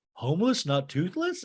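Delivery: imitated baffled check, not shouted; mock puzzled question. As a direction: ask it back innocently, in mild confusion. [name] asks back, puzzled.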